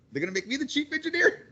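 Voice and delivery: can't believe your luck, asking out loud; squealing surprise at a sudden promotion chance; incredulous excitement. Retold or imitated. Hopes for promotion after firing.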